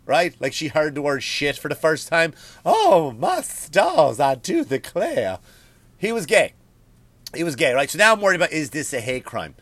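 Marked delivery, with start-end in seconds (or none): 2.6-5.4 slow, drawn-out Southern belle. Retold or imitated. Comic mocks the man as a Southern belle, slow drawl. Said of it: "Like a Southern belle".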